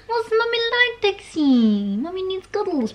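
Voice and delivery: very high, swooping cooing; high-pitched mummy-to-puppy voice. Real, not acted. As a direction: squeal it sweetly to a pet. Baby talk to her dog.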